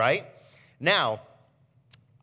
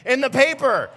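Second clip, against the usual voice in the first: high, loud voice of the wife scolding; wife lecturing her husband in the car, high and loud; mock-scolding wife; 9 semitones higher, 6 dB louder than usual. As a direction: play the exasperated wife, hammed up. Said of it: "She's like".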